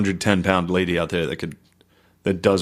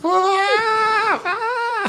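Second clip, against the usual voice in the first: very high shrieking imitation; mock screaming woman. Mocking a woman screaming at a crane collapse, high shriek. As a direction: shriek like a panicked woman far off. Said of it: "she was just like".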